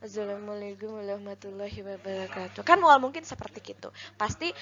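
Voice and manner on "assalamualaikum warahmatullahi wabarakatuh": soft, indistinct mumble on one level; mumbled greeting. Shown as a demonstration. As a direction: mumble it under your breath like a bad speaker. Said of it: "aya nu biantara tapi ngagerenyem".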